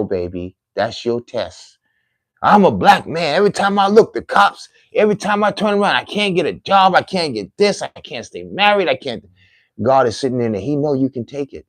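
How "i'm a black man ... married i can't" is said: higher-pitched whining litany of excuses; woe-is-me lament; mock complaining. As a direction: rattle off grievances in a high, put-upon voice. Voices a complainer making excuses.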